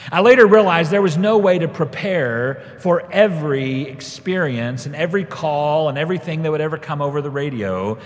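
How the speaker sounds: ordinary delivery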